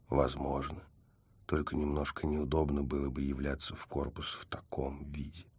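low, very soft murmur; hushed reply. A narrator's reading. Hero answering her nibbling his ear, hushed. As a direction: murmur it, fading almost to a whisper.

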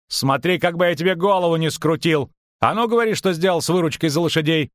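high-pitched, irate threat; threatening demand. A narrator's reading. Merchant demands the horse money from his double, threatening.

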